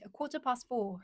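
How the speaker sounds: British "quarter past four", no R's; British accent with long open "past". Shown as a demonstration. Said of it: "British accent".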